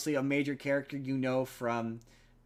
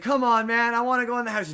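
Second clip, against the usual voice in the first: higher and louder wheedling; mock whiny begging; 9 semitones higher, 7 dB louder than usual. Historian begging the group to let him in, whiny. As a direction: plead like a stubborn kid. Danny DeVito begging to enter.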